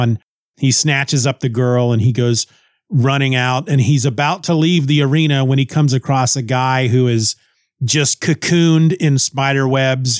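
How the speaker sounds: ordinary delivery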